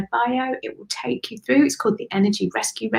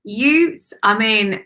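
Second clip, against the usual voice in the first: usual speaking voice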